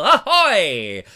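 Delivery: hammy sea-captain alarm call; pirate voice. Pretend. Pirate calls after Ahoy.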